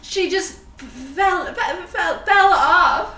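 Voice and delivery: laughing. Acted. Player laughing while explaining.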